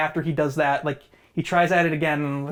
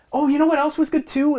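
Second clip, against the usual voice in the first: sudden recall; 10 semitones higher, 4 dB louder than usual. Real, not acted.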